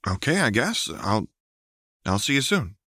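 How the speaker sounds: unsure acceptance; resigned. A narrator's reading. Lux resigned.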